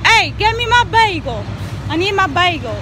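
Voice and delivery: usual speaking voice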